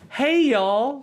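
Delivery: mock high greeting from a friendly stranger; high-pitched sweet guy. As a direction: do the Costco guy's piping hello. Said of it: "He had a super high-pitched voice".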